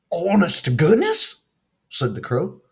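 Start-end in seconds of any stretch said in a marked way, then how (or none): 0.1-1.4 surprised. A narrator's reading. Crow surprised.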